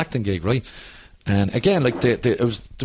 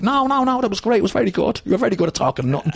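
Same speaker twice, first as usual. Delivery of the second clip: reassuring extras; 10 semitones higher than usual. Retold or imitated. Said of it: "they were like".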